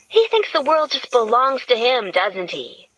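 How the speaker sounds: mock indignation about the boxes; lightly voiced Gretchen.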